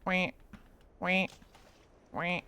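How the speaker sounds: ordinary talk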